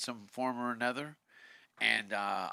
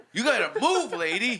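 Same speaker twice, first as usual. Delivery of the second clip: higher, louder yell at the lady; louder mock warning to the lady; mock warning shout; 7 semitones higher, 5 dB louder than usual. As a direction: bark it out as a joke warning. Mock warning in fart story.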